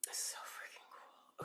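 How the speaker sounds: very soft, amazed aside; awed whisper. Real, not acted. Reader marvels to herself at the hawk, awed whisper. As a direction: whisper to yourself in real awe.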